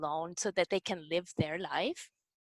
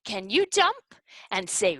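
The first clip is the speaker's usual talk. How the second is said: ordinary talk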